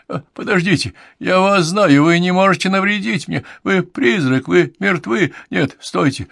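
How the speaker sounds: high, loud, rising terror; panicked choking plea. A narrator's reading. Medium relives her death by strangling, panicked plea. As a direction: build from alarm to high cries for help. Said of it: "она хрипела от удушья".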